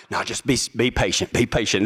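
low, lightly voiced manager reassurance; store manager, placating. Store manager to waiting applicant, mildly placating. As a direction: drop low to calm an impatient caller. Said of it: "This is the store manager".

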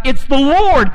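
high, loud excited call across the water; John calling out to Peter from the boat, thrilled; hollering. Retold or imitated. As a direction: shout it out to the boat. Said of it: "John hollers out".

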